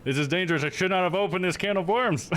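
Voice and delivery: high-pitched playful alarm, ending in laughter; mock alarm. Streamer regretting letting chat bait accents, mock alarm.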